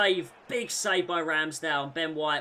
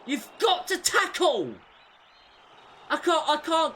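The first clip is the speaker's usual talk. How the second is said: frustrated shout; 11 semitones higher, 5 dB louder than usual. Real, not acted. Frustrated at players.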